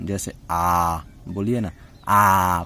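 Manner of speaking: आ drawn out slowly with the mouth wide open. Shown as a demonstration. Teacher showing the open vowel आ, drawn out. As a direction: hold a long open आ. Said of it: "विवरित या open".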